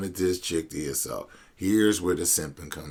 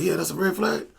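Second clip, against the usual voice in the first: much higher, sheepish imitation of the friend; mock the friend's high, caught-out voice; 7 semitones higher, faster than usual. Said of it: "he's like".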